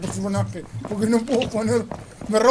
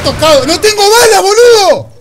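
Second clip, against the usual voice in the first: loud, high panicked yell; panicking gamer; 9 semitones higher, 10 dB louder than usual. Real, not acted. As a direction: shout it in panic. Out of ammo mid-fight.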